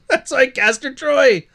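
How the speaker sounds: high, slow, gushing with a laugh in it; laughing while mocking a smitten fan. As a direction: gush even harder, laughing at it.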